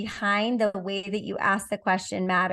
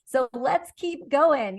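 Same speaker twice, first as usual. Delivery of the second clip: higher, bright, slowing on keep going; upbeat determined tone; 5 semitones higher than usual. Retold or imitated. High performer facing a challenge, determined. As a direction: cheer yourself on past resistance. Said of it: "you're like".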